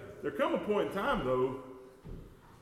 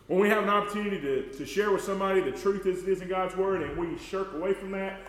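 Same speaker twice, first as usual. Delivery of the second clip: building, louder preacher cadence, driving the point home; rising preaching; 3 semitones higher, 4 dB louder than usual. Acted. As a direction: press harder and faster as the point builds. Preaching climax.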